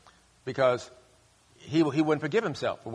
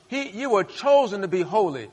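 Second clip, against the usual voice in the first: normal talking voice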